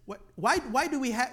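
ordinary talk

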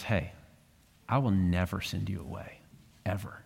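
put-on low, soft, gentle promise to a child; tender fatherly reassurance. Pastor voicing the dad reassuring his crying son, tender and soft.

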